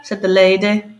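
ordinary delivery

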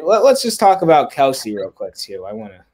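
normal talking voice

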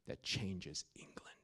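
slow, soft whisper; hushed awe before the punchline. Acted. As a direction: drop to a slow reverent whisper. Dramatic hush in sermon.

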